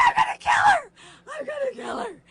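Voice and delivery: imitating her own rage at the daughter; furious mock shout. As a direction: scream it like you've lost it. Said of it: "I'm like".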